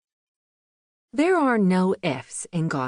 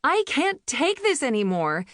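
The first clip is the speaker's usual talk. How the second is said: higher, breaking-point outburst of despair; desperate cry; 7 semitones higher than usual. A narrator's reading. Mother at her limit in the truck, desperate higher cry. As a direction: let it burst out, near tears. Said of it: "and cried".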